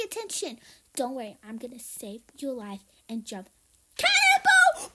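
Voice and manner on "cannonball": big excited yell, much higher and louder; shouted cannonball. Pretend. Kid leaping into water, yelling loud. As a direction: belt it out as you leap. Jumping in to save sister.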